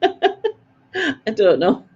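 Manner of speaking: ordinary talk, nothing marked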